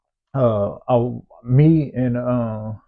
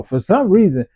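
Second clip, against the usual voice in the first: ordinary delivery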